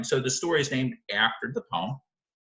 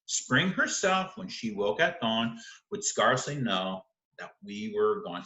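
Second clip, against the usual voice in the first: poem reading; 5 semitones lower than usual. Acted. Teacher rereads final stanza.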